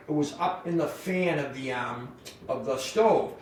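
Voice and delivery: ordinary talk, nothing marked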